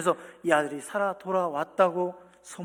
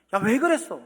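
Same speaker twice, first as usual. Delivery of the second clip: sharp, higher, louder rebuke; a harsh, scolding God who never speaks; mock angry scolding; 5 semitones higher, 7 dB louder than usual. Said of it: "이렇게 하지 않았어요".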